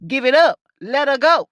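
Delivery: lightly acted police order; mock police standoff demand; police officer. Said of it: "like the police".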